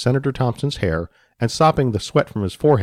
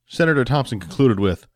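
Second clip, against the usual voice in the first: plain reading voice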